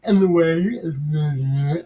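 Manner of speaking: in the way stretched very slow and odd; drawn-out goof. Pretend. Host correcting himself, then drawls in the way very slowly. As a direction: drag in the way out slowly, playing silly. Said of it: "having a moment there".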